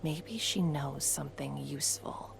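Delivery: hushed whisper to a companion. Acted. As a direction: whisper it so she can't hear. Aside about the merchant nearby.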